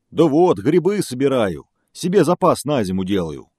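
loud lumbering bear; big bear voice. Acted.

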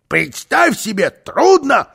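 loud retort leaping high; butting-in retort. A narrator's reading. Karlsson butting into the argument, emphatic retort. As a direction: jump in, pitch shooting up on the last word. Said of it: "вмешался Карлсон".

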